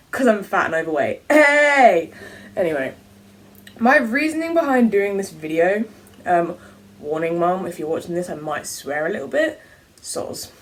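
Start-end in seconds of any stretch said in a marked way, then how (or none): 1.3-2.1 loud, high indignant "hey"; indignant shout. Real, not acted. Indignant outburst at own joke. 10.0-10.5 breathy whispered "soz"; whispered apology. Real, not acted.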